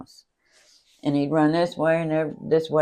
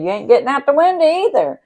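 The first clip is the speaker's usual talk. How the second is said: higher, louder bargaining taunt; 10 semitones higher, 7 dB louder than usual. Retold or imitated. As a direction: gloat through the window. Brother taunting locked-in mom.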